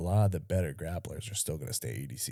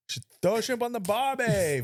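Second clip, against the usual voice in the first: higher, hammed-up Aussie cliché; mock Australian accent; 13 semitones higher than usual. Co-host riffing in a bad Australian accent, higher. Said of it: "Australian accent".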